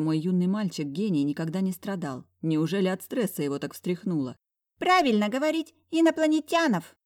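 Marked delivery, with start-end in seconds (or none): 4.8-7.0 put-on child voice. Boy corrects grammar in child voice.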